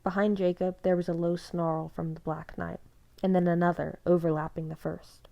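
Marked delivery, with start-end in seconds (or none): none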